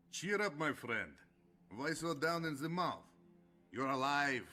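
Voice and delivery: high, hearty and exuberant; rally a gloomy friend, pitched well up; boisterous cheering-up. Acted. Sergeant cheers up gloomy comrade.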